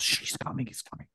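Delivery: hushed lookout warning; imitating a furtive heads-up; urgent whisper. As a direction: whisper it as if they might hear. Secretly warning that someone is coming.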